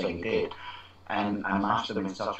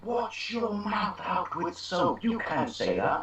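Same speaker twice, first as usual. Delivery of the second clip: slow, high-pitched mock telling-off; scolding teacher; 9 semitones higher, slower than usual. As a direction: scold like an outraged teacher, drawn out. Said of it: "a teacher came running out".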